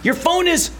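high-pitched, stammering call down the phone; urgent calling out. Acted. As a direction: try to get through to someone who isn't hearing.

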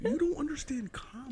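high, whispered mock voice; teasing echo of the Jordan Peterson impression. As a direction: breathe it out in a squeaky put-on voice. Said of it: "old Irish woman".

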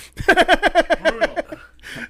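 high, loud cackling laugh; high laughing fit. Real, not acted. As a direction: crack up high at your own punchline.

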